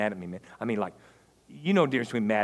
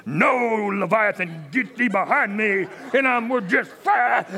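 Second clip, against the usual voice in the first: loud, high, commanding rebuke shout; mock exorcism; 9 semitones higher, 6 dB louder, slower than usual. Husband mock-rebuking his angry wife like an exorcist, shouting. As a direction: cast the demon out, hammed-up.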